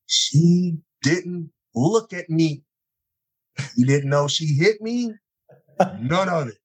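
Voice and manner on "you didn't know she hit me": ordinary talk, nothing marked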